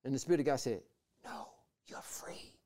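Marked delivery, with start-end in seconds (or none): 1.2-2.5 hushed divine whisper; whispered reassurance. Retold or imitated. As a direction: whisper it as the Spirit's answer. Voices the Spirit of God.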